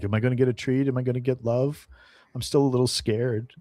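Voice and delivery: a hint of an anxious pup; hopeful dog. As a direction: pipe up like a timid dog hoping for love. Voices the timid hopeful dog.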